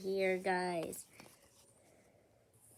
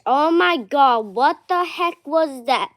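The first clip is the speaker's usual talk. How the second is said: startled shout; 12 dB louder than usual. Real, not acted. Startled outburst at sudden event.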